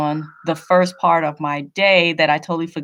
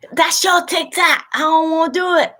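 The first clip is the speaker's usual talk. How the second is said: higher, slightly faster mock-kid whine; imitating her son; 10 semitones higher than usual. Mother imitating her son refusing TikTok dances, mock whine. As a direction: lightly mimic a reluctant teen. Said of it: "I got to beg him".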